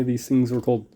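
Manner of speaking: ordinary talk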